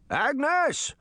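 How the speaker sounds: high-pitched holler for Agnes; old man's shout. A narrator's reading. Senior citizen in his yard, shouting to Agnes. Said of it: "heard the man shout".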